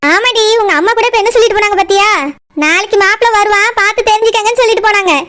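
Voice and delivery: normal talking voice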